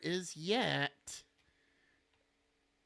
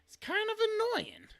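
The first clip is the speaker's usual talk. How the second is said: squeaky whiny voice; 4 dB louder than usual. Pretend. Complaint in high put-on voice.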